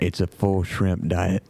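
slow, low Cajun man; drawled Cajun shrimp lover; Cajun accent. Pretend. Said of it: "Mister Cajun Man".

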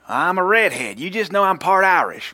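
high, loud hillbilly excuse-making; hillbilly accent. Retold or imitated. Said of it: "You sound hillbilly".

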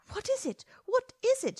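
mother rushing in, panicked, crying out; alarmed mother. Acted. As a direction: burst in frightened, calling out. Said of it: "she cried".

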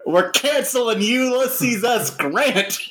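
high-pitched, emphatic proclamation; hammed-up high-pitched battle cry; mock rallying cry. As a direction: rile up the crowd, stretching the key words.

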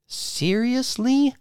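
high, slow disbelieving Seriously; shocked. Acted. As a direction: stretch it out in disbelief. Wife reacts to quitting.

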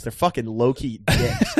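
ordinary talk

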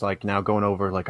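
ordinary delivery

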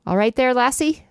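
mock Scottish accent, high; a bad Scottish burr. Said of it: "I can't do a Scottish accent".

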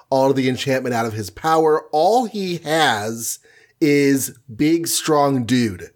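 ordinary talk

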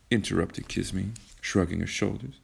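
ordinary delivery, nothing marked

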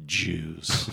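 low, flat-dropped mock line; deadpan one word. Mocking the film's solemn one-word answer, low. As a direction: drop it low and soft, dead serious. Retelling movie dialogue, deadpan.

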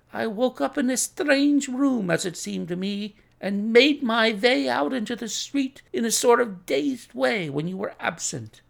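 high-pitched apologetic patient; Russian patient explaining. Acted. Patient explains his attack to the doctor, high voice.

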